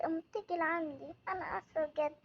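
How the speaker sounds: ordinary talk, nothing marked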